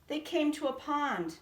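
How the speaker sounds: ordinary delivery, nothing marked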